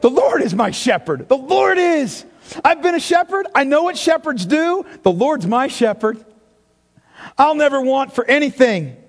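exultant declaration of trust; confident, joyful. Shown as a demonstration. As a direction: proclaim it like a shepherd who knows. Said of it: "It's not how he's saying it. He's like".

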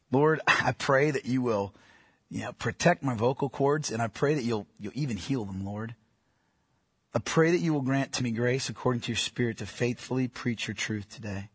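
strained, thin voice; thin reedy voice. Real, not acted. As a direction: voice worn thin, speaking low. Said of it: "My voice is thin".

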